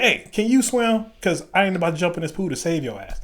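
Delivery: high, emphatic mock challenge; imitated instructor's sceptical demand; challenging instructor. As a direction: warn him off, drawing out the question. Imitates instructor challenging a doubted swimmer.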